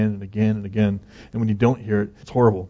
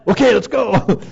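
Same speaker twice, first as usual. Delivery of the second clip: high, loud burst of readiness; excited playful shout; mock eagerness; 10 semitones higher, 6 dB louder, slower than usual. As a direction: call it out, raring to go.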